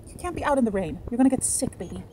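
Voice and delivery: high coaxing voice for a pet; sing-song calling the dog inside out of the rain; pet talk. Real, not acted. Woman calling her pet in from the rain, in high coaxing pet talk.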